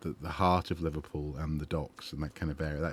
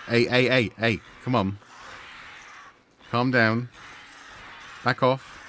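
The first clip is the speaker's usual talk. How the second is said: louder, higher hey hey, come on, back off; shooing shout; 5 semitones higher, 4 dB louder than usual. Real, not acted. Fending off attacking crows, shouting them away.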